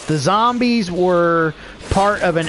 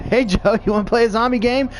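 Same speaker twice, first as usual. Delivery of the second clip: higher, chirpy mock invitation; eager pestering friend; 7 semitones higher than usual. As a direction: bug your buddy over and over, higher. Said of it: "And he's like".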